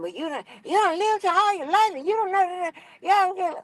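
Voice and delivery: high-pitched mimicry of someone condescending; mocking imitation. Imitating him looking at her like she's dumb, mocking high voice. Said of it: "he looking at me like I'm dumb".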